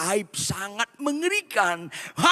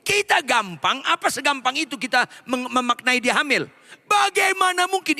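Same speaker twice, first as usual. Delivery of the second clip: higher, rising to a shout; preaching; 7 semitones higher than usual. Real, not acted. Preacher challenging the congregation, shouting. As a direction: challenge the listeners. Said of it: "preacher exhorts congregation".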